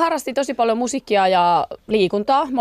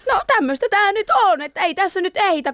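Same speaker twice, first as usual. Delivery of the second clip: airy, much higher, parents too busy to fuss; mock parent voice; 12 semitones higher than usual.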